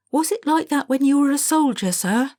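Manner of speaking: high, loud mock-innocent schoolboy question; sly pupil. A pupil lures the teacher into reminiscing, high and innocent. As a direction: bait the teacher with a wide-eyed question.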